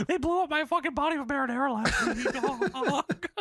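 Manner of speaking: put-on high, strained old-man squeak; hammed-up high Paul Bearer whine; squeaky Paul Bearer voice. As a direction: squeal it like a pathetic old ghoul. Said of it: "Paul Bearer says".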